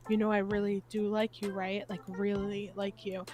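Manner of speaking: earnest confession. Retold or imitated. Imitating someone confessing feelings face to face, soft earnest. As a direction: say it quietly so it sinks in.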